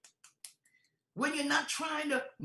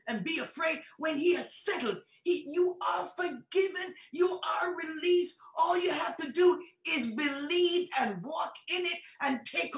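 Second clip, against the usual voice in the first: raised, forceful preacher's voice; impassioned preaching; 4 semitones higher, 4 dB louder than usual. Real, not acted. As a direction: drive it home to the congregation, higher and punchy.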